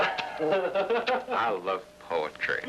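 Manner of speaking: very slow, savouring each word; drawn out. Real, not acted. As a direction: stretch it out, relishing.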